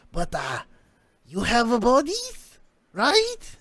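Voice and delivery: high, slow, strange little man begging; odd scientist voice. Acted.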